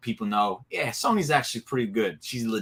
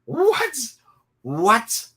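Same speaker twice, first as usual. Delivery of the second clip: excited disbelief; 11 semitones higher, 5 dB louder than usual. Real, not acted. Hyped match announcement.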